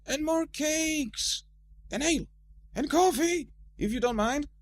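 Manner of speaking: dwarfs calling from outside; called from afar. A narrator's reading. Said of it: "called the other dwarfs through the door".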